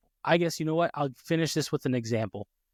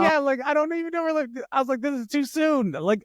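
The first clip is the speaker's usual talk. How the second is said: panicked disbelief; 11 semitones higher, 4 dB louder than usual. Retold or imitated. Recalling panic leaving hospital.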